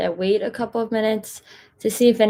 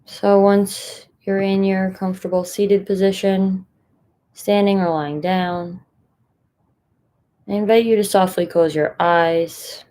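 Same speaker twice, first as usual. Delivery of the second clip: unhurried guidance with long pauses between phrases; guided meditation. Acted. Meditation leader inviting listeners to find a comfortable position, guided meditation. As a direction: settle listeners in, leaving space after each instruction.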